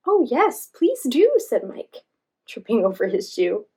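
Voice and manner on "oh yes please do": high, loud eager reply. Acted.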